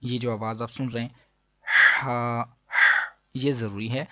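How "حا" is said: ہا with a strong mid-throat h; throaty haa. Shown as a demonstration. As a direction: push ہا out from the middle of the throat. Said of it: "حلق کے درمیان والے حصے سے ادا ہوتا ہے". (ہا حلق کے درمیان سے)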